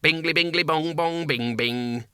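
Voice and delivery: sing-song clock demon; demon chime. A narrator's reading. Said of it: "said the demon".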